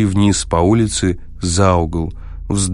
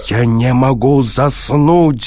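raised, drawn-out exasperated shout; exasperated cry; 5 semitones higher, 4 dB louder, slower than usual. A narrator's reading. Sleepless guest cutting off the machine's advice, exasperated cry. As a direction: cry out higher, stretching the words. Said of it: "закричал Кармоди".

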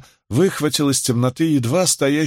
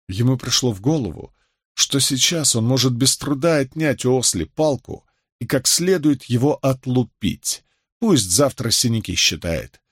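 ordinary delivery

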